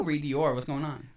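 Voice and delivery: normal talking voice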